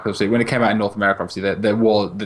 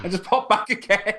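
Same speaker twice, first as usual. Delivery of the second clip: lilting mock; 9 semitones higher than usual. Continuing the mock voice.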